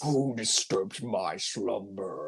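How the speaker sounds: deep, slow rumbling cave voice; grinding stone statue. Retold or imitated. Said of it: "I love that deep voice".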